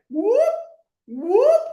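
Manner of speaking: be silly with the milkshake cup; silly whoop. Shown as a demonstration. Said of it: "be silly".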